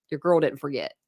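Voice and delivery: normal talking voice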